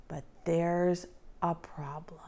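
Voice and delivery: hushed story whisper; whispered suspense. A narrator's reading.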